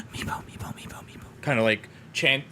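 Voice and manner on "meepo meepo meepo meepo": breathless whispered name, over and over; hushed, frightened voices behind a door; softer and softer whispered calling; eerie whisper. Acted. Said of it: "like whispering his name".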